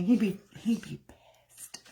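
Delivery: soft breathy whisper, slowed down; whispered aside. Real, not acted. Vlogger whispering to viewers about the shaved dog. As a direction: whisper it like a naughty secret.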